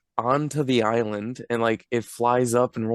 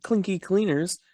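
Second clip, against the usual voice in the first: climbing much higher and faster; building indignant disbelief; mock outraged rant; 6 semitones higher than usual. Real, not acted. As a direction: rant it, rising to a squeal. Mock outrage about the lost finger.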